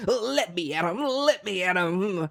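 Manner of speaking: mock Bonnie as a tiny brawler begging to fight; mock Scrappy-Doo. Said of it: "like a, like, fucking Scrappy-Doo".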